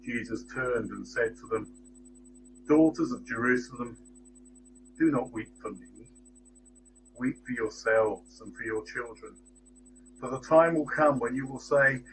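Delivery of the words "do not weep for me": ordinary talk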